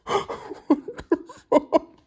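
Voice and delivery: high, loud laugh through the words; drawn-out, incredulous outburst breaking into laughter; shocked laughing. Real, not acted. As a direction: laugh in real disbelief, high and slow. Reeling from shocking book twist.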